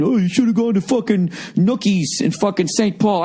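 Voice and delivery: high, whiny know-it-all voice; mock a smug local correcting you; know-it-all fan. Mocking fans tweeting burger tips, high whiny voice. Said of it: "tweets from people going".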